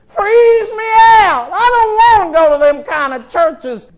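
high, loud, crying out; mock horrified plea. Preacher pleading with Elijah not to go to dead churches, crying out. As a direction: wail the refusal.

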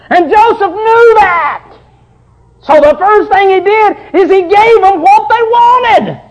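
loud, high revival preaching; preaching at full force. Acted. Preacher thundering the Joseph story to his church, loud and high. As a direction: preach it out to the congregation.

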